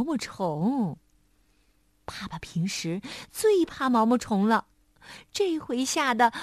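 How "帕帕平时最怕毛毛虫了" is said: ordinary delivery, nothing marked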